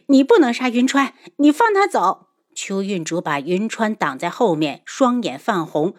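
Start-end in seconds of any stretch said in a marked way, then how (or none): 0.1-2.3 higher, louder urgent protest; urgent plea. A narrator's reading. Daughter begging her father to spare Yunchuan, urgent. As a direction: burst out pleading for his life.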